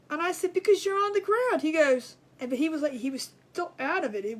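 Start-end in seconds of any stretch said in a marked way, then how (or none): 0.5-1.6 loud, much higher, incredulous; exasperated alarm. Retold or imitated. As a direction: tell him the obvious in panic. Alarmed wife to dazed husband.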